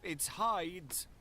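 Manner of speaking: high, urgent cry; cried out. A narrator's reading. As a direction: cry it out in alarm. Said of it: "cried Utterson".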